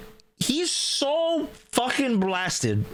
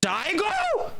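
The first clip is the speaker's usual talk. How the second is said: very high disbelieving Daigo; surprised recognition; 12 semitones higher than usual. Retold or imitated. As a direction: realize who it is, pitched way up. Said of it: "I'm like".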